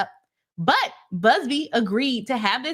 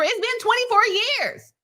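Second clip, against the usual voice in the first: mock voice. Mocking take, then repeated plainly.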